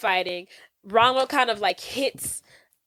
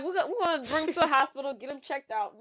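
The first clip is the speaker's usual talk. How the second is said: hurried mock panic; 3 semitones higher, 6 dB quieter, much faster than usual. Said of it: "I'm like".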